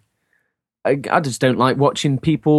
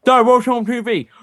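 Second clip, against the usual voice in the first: higher-pitched put-on kid voice; child asking dad; 9 semitones higher, 3 dB louder than usual. Child turning to dad at dinner, innocently asking.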